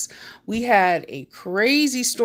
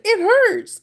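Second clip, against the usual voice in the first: high, louder whine; whiny complaint; 6 dB louder than usual. Retold or imitated. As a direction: complain like a hurt kid. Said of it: "I'm like".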